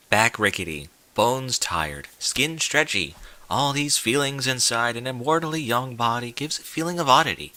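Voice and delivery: plain reading voice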